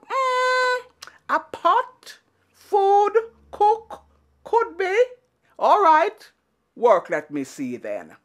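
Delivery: scheming Anansi. Acted. Anansi plotting to get food from the pot, high and sly. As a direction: think it through, sly and eager.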